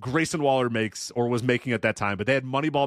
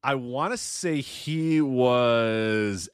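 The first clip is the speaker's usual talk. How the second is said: drawn out; much slower than usual. Acted. Hedging guess about wrestler's promotion.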